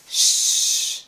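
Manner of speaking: a long hushing sh- with no voice; isolated sh sound. Shown as a demonstration. Teacher isolates the sh of sheen for learners. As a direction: hold the sh- sound alone. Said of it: "like shorts".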